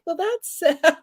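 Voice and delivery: high, laughing fake pity; laughing mock sadness. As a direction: pretend to pity them. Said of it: "And I'm like".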